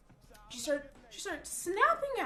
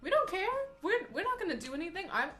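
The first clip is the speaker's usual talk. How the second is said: higher and louder, breezy don't-care shrug; careless coworkers; 6 semitones higher, 5 dB louder than usual. Retold or imitated. Coworkers telling the new girl they never stop shoplifters, breezy and careless. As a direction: toss it off like it's nothing. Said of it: "was their attitude".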